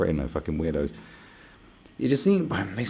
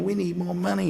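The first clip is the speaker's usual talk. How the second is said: mock whine; 12 semitones higher, 4 dB louder than usual. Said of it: "they're like".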